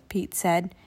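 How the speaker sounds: usual speaking voice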